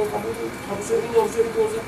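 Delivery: a preachy push of "you should"; pushy lecturing. Retold or imitated. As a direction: lecture him like a know-it-all salesman. Pushing a product on someone.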